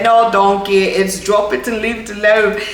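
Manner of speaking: playful mock British accent for Shrek's line. Vlogger repeating Shrek's line in a mock British accent. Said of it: "why it keeps turning to a British accent".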